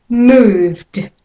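Moved, V straight into D, no vowel between; moved with a final d. Shown as a demonstration. Teacher models moved for learners. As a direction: buzz the V into the D in Moved. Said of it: "from the V to the D sounds".

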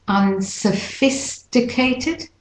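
unsophisticated slowly split, un-suh-FIS-tuh-kay-tid; de-stressed middle vowel. Shown as a demonstration. Teacher breaking it down, unsophisticated slow with schwa. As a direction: separate unsophisticated, keeping a short tuh after FIS. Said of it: "not sophisticated".

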